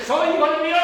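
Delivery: high, loud shout. Shown as a demonstration. Preacher acting out shouting in the streets, loud. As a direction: cry it through the streets. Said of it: "deberíamos de salir de aquí gritando".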